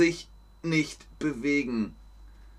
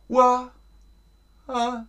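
echo imitation; 5 dB louder than usual. Shown as a demonstration. Teacher demonstrating resonance, a high echoing call. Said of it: "Ein Echo zum Beispiel".

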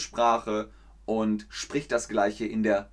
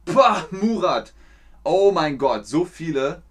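excited; 4 semitones higher, 6 dB louder than usual. Real, not acted. Delighted reaction to a viewer.

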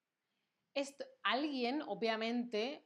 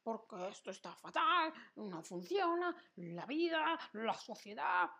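mock complaint. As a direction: play the complainer, moaning a little. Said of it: "Quejarse es como".

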